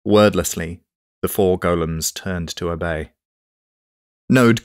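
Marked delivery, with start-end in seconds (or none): none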